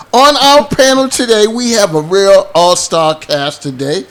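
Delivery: ordinary talk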